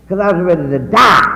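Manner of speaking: ordinary talk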